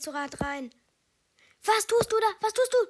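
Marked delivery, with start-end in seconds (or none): 1.6-2.8 loud, high-pitched yell at a teammate; high, shouted exasperation; exasperated yell. Real, not acted. Yelling at a teammate's move.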